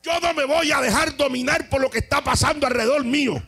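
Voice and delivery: thunderous shout, slowing at the end; Jesus shouting. Acted. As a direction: roar it over the mourners.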